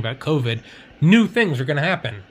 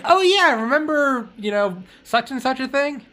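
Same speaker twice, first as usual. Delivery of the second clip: high, bright oh yeah of sudden remembering; mock surprised recall; 12 semitones higher, 4 dB louder than usual. Mocking how people recall old news, high and surprised. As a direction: act like you just remembered.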